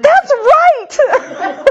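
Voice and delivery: very high, laughing confirmation; laughing agreement. Retold or imitated. Mother agreeing with her son, laughing. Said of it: "I said".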